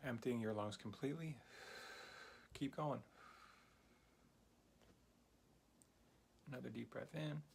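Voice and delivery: soft, unhurried breathing guide; guided meditation. Acted. Teacher guiding a beginner's breathing exercise, soft calm meditation voice.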